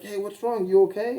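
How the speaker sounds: lightly voiced boyfriend asking after her; imitated concerned partner.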